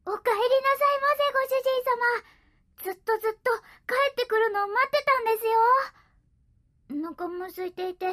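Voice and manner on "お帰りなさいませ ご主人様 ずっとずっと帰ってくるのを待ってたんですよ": high, loud, fast maid welcome; sugary maid cafe greeting. Acted. Cat greets master maid-style.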